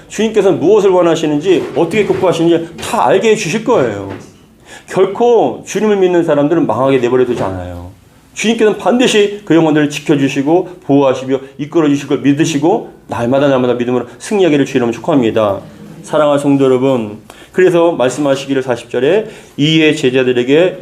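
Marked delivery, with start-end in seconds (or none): none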